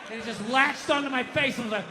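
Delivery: usual speaking voice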